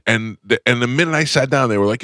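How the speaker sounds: normal talking voice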